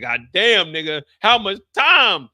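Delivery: loud, slow, strained gasping; choked plea. Retold or imitated. Mo choked in a match asking coach, loud strained plea. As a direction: squeeze it out while being choked. Being choked while wrestling.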